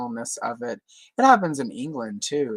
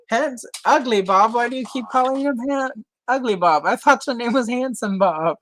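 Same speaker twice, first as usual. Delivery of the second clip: mock cartoon voice; 9 semitones higher, 5 dB louder than usual. Quoting South Park scene.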